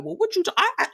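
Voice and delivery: высокий, запинающийся голос вруна; stuttering liar. Retold or imitated. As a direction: stammer high like you've been caught lying. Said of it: "he go to stuttering".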